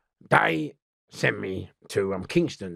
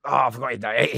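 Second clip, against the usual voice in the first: ordinary talk, nothing marked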